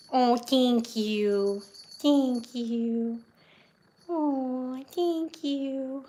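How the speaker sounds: slow, drawn-out cooing thanks; tender thanks to pet. Real, not acted. Thanking her cat for cuddles in a slow coo. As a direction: stretch it out gently.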